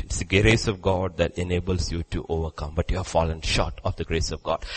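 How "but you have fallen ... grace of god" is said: ordinary talk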